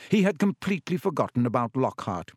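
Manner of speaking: ordinary talk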